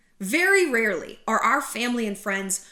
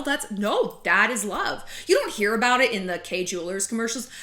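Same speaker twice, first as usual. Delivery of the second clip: ordinary talk, nothing marked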